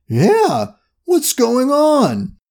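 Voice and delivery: high, drawn-out bewildered question; baffled question. A narrator's reading. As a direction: stretch out the puzzled reply at a high pitch. Baffled police partner replies.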